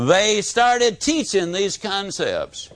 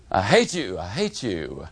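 echo; 10 semitones lower, 4 dB quieter than usual. A narrator's reading. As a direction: lower and fading away like a distant echo. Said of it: "the echo came back".

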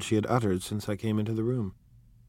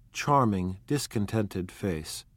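ordinary delivery

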